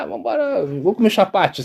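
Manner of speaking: high-pitched, offhand mock dismissal; imitated brush-off. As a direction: wave them away in a light, high voice. Drivers brushing off the plea. (imitando descaso, com voz aguda)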